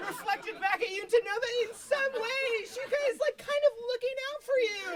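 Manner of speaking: high, laughing overexcited gushing; squealing fan rant. Retold or imitated. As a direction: rave about it like it changed your life. Overexcited rant about Dory.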